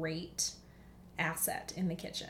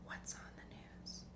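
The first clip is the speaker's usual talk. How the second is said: hushed puzzled whisper; 15 dB quieter than usual. Retold or imitated. Replying to worried family, puzzled whisper. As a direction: whisper the question back. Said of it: "And I'm like".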